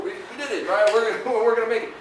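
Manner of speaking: cheering with a laugh at the first customer; a high woo, then laughing it out; whooping first success. Retold or imitated. New business owner celebrating his first customer, laughing and cheering.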